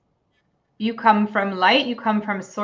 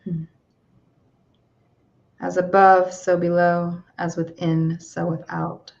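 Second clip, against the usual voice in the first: calm, unhurried meditation guide; guided meditation; 3 dB louder than usual. Acted. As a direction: gently bring them back to the room.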